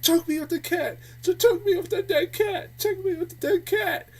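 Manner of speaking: high, urgent mock pleading; frantic caller impression. Reviewer mimicking the woman during phone sex, frantic. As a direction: beg in a high, insistent put-on voice.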